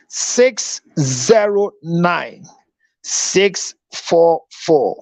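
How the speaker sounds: very slow, spelled-out digits; slow number read. Shown as a demonstration. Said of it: "Your number again".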